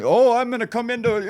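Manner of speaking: a hint of a pompous politician; mocking the candidate. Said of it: "he's just some fucking ex-Marine".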